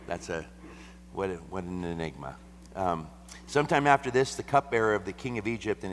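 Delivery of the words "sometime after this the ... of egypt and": high, loud scripture opening; raised voice. Acted. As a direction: proclaim it to the room. Reads scripture aloud again.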